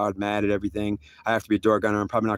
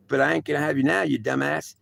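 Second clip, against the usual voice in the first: ordinary delivery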